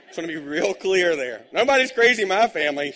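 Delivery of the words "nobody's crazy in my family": loud, high joking protest; mock denial. Pastor joking with the congregation, loud mock denial. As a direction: insist it with a grin.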